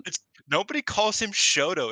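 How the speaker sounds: high, loud disbelief; can't believe what they just heard; laughing outrage over a character name; incredulous. Real, not acted. Incredulous at cohost's name choice.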